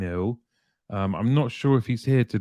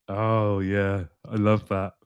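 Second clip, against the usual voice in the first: usual speaking voice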